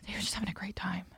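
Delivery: soft unvoiced whisper, a bit fast; a fond whisper about the chickens. Real, not acted. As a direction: breathe it out conspiratorially. Chickens living happily.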